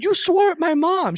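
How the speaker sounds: high, slow accusing voice. Retold or imitated. A son accuses his companion on the drive home, high and slow. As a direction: point the finger up high and slow. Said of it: "It's like".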